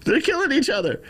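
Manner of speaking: high, frantic imitation of a scared kid; panicked kid. Streamer imitating Chicago kids posting panicked TikToks, high frantic mock kid voice. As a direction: squeal it like a frightened city kid. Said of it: "they're like".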